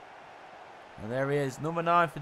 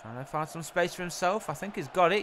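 ordinary talk, nothing marked

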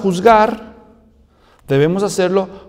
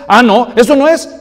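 indignant protest; 4 semitones higher, 8 dB louder than usual. Acted. Wronged person objects.

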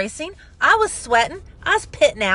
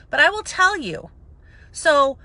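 normal talking voice

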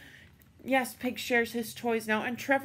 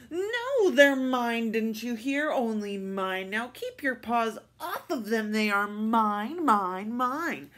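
tantrum scream; 5 dB louder than usual. Acted. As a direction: throw a full tantrum over the toys. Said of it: "he started to scream".

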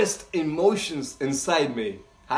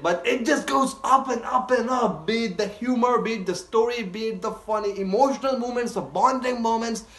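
high-pitched gushing list; enthusiastic praise; 5 semitones higher than usual. Real, not acted. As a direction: pile up the praise excitedly. Praising the series.

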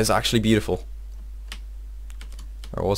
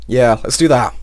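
normal talking voice

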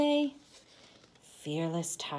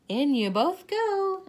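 brisk tuck-in; 11 semitones higher, 8 dB louder than usual. Acted. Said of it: "I say".

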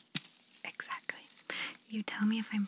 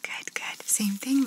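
usual speaking voice

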